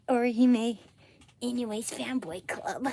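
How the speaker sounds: high, loud gushing fanboy; excited anime fan. Pretend. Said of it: "Just have to get into character".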